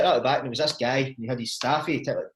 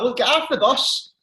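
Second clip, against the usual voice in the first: stern bus driver; 9 semitones higher, 5 dB louder than usual. Retold or imitated. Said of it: "the bus driver's like".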